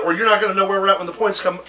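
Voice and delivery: ordinary talk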